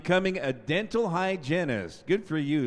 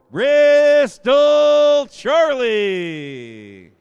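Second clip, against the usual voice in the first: drawn-out ringing name call, much louder and higher; announcer call; 10 semitones higher, 17 dB louder, much slower than usual. Real, not acted. Announcer calls the next senior to the crowd, long ringing shout. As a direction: stretch the name out for the gym.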